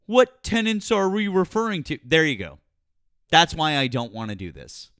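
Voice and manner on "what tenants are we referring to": high, loud mock echo of a question; mocking repetition. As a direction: throw the question back mockingly, pitched high. Mockingly repeats the other speaker's question.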